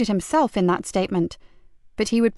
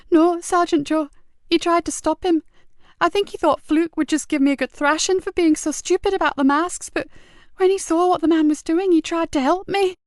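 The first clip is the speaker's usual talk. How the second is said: put-on tearful boy; 8 semitones higher than usual. Boy tearfully defending his friend to the sergeant. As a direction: hold back tears while telling it. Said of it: "The tears welled up again".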